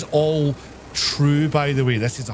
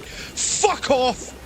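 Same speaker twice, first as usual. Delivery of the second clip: higher-pitched imitation of a threat; mock-menacing, pitched-up outburst; retold knife threat; 7 semitones higher, slower than usual.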